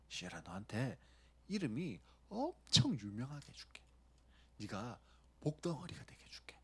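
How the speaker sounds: hushed, softly whispered promises; a God luring Abraham in murmurs; coaxing God. Retold or imitated. God coaxing Abraham with promises, softly whispered. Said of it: "아브라함을 꼬시는데".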